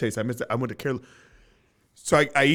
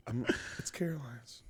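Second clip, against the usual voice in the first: softer, whispered; 13 dB quieter than usual. Retold or imitated. Host answering wife caught eyeing restaurant, whispered. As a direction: sheepishly whisper the excuse.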